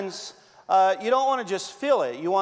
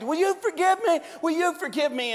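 mock pleading; 9 semitones higher than usual. Debtor begging the king.

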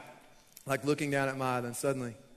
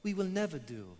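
very calm; 5 semitones lower, 8 dB quieter than usual. Retold or imitated. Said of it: "really calm, very calm".

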